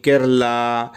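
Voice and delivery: ordinary talk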